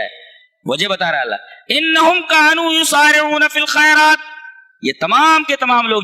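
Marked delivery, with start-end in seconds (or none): 1.7-4.2 Quran recitation. Acted. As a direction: intone the verse like a reciter.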